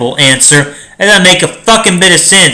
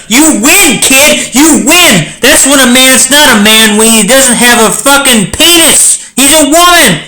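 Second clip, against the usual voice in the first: excited cheer; 6 semitones higher than usual. Real, not acted. Celebrating the riddle answer.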